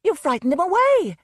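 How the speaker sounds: high, loud furious wailing. Acted. Susan blaming the man who scared her panther, furious loud wail. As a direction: wail it out in fury. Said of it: "Susan wailed furiously".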